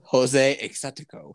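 name said the Spanish way, rising. Pretend. Friend asks for it the Spanish way, mock Spanish. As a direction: Hispanicize the name playfully. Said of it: "how would you say that in, like, a Spanish way".

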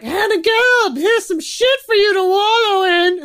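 high, loud, whiny mock boss voice; a nagging boss dumping work on her, hammed up. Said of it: "people are like".

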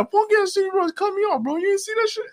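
high-pitched indignant rant; high outraged friend impression. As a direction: squeak it up, outraged. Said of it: "'Cause he's, yeah".

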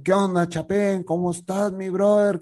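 put-on high, excited greeting to an old friend; Ana's greeting. Friend Ana greets him warmly. (saludo alegre y agudo)

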